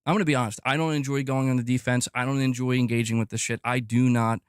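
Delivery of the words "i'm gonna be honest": usual speaking voice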